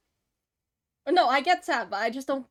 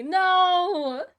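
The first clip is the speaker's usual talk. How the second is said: frustrated cry; 7 semitones higher, 11 dB louder than usual. Real, not acted. Can't find bee image.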